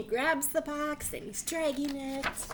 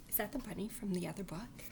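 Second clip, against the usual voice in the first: gasp of surprise; 7 dB quieter, faster than usual. Acted. Playful surprise at picture.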